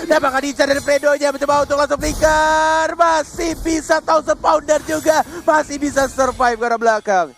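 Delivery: fast, loud play-by-play hype; esports caster. Real, not acted. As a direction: call the fight like a caster. Live play-by-play of fight.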